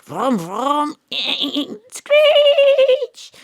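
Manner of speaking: revving vroom, then a loud high tyre screech; mouth car noises. Acted. Detective voices her car pulling away, mouth sound effects. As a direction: play the getaway car with your mouth.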